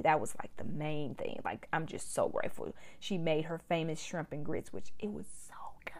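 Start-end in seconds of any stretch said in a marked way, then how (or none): 1.4-2.8 whispering. Real, not acted. Aside about mom's cooking. 4.7-5.9 breathy whispered aside; whispering. Real, not acted. Aside about mom's shrimp and grits.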